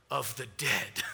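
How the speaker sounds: low, ominous mock gloom; grim low voice. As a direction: make it sound as grim as possible. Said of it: "he's like".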